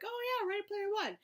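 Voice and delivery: high-pitched mock realization; excited self-quote. Vlogger quoting her reaction while reading, high and excited. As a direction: pitch it way up, imitating sudden recognition. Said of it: "I'm like".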